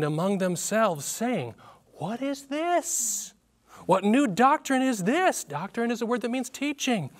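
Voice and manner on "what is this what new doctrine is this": higher, slow astonished question; amazed crowd. A narrator's reading. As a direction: read it as a stunned crowd asking. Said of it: "they were all amazed".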